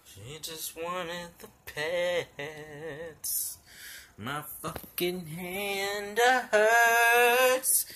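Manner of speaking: slow mock whimper; slow whine. Real, not acted. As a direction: stretch the complaint out. Drawn-out whining.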